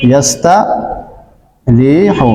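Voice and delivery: very slow demo. Shown as a demonstration. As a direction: stretch every syllable of ইয়াস্তারিহু. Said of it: "যেমন দেখেন".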